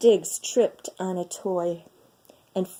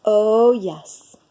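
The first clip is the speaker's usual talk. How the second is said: louder catlike agreement; cats agreeing; 5 dB louder than usual. Acted. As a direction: purr out a cat's eager yes.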